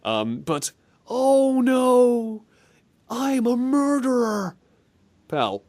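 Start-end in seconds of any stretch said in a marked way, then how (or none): 1.1-4.6 higher, slow mock despair; imitating the game voice. Copying the game line for his cohost, slow and high. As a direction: drag out the line like a bad game actor. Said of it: "this final sample".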